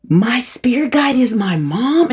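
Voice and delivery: high, slow question of disbelief; incredulous. Retold or imitated. Waking to find mom is her guide, incredulous. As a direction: draw it out in astonishment.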